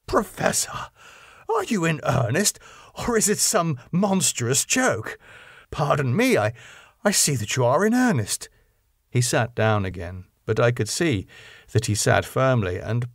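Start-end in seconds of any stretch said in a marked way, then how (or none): 0.0-8.5 higher, incredulous challenge; can't believe he means it; aghast, almost breathless; shocked disbelief. A narrator's reading. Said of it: "Arthur stood up".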